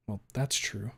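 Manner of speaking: plain reading voice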